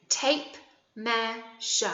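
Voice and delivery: "Tape measure" very slowly, in three separate beats; slow, fully separated syllables on "Tape measure"; syllable counting. Shown as a demonstration. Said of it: "count the syllables this time as we say it".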